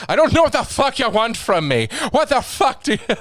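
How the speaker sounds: high, loud, put-on frustrated comic; mock exasperated rant. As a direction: raise pitch and vent at the silent crowd. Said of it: "And he's like".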